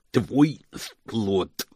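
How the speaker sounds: loud, odd-timbred henchman announcement; servant Igor. Acted. Igor handing Moist the cup, curt lisping servant. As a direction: thump out both words in a servant lisp.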